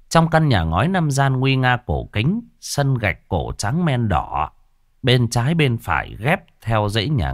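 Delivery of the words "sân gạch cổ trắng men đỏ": usual speaking voice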